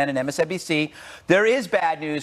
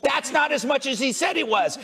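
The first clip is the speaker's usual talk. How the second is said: mock media; 9 semitones higher than usual. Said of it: "They go like this".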